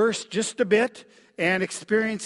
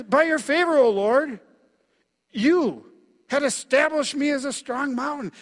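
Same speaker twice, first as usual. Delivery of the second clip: higher and louder, ringing; confident praise; 6 semitones higher, 4 dB louder than usual. Acted. Preacher reading verse seven to congregation, confident. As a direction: declare it like standing firm.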